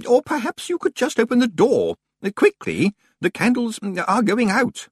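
high, halting fusspot voice; anxious senior librarian. Acted. Senior librarian urging colleague to open door, high and halting. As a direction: urge politely, pitched high with little hesitations.

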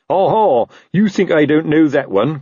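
a touch of paranoid shouting. A narrator's reading. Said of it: "Colon shouted".

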